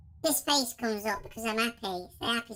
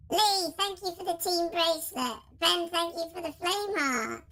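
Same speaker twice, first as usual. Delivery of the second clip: squeaky voice; 4 semitones higher than usual. Shown as a demonstration. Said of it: "the squeaky one".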